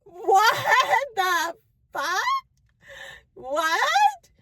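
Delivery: high, stunned protest; shocked disbelief. Retold or imitated. Hearing her name announced, high shocked protest. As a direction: recoil in horror at being called out. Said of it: "And I was like".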